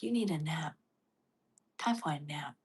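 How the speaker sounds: low, soft drowsy voice; a lazy sleepy murmur; sleepy body voice. Pretend. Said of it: "your body's like".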